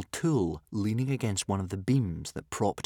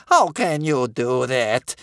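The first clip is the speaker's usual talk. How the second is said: slow, loud, higher grandmother voice; spat disbelief; 5 semitones higher, 10 dB louder, slower than usual. A narrator's reading. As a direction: spit it out, unbelieving. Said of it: "she spat in disbelief".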